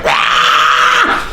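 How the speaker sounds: high-pitched mock rage cry; mock angry yell. Imitating the raging partner, high mock yell. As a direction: squeal like a furious little person. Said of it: "And they're like".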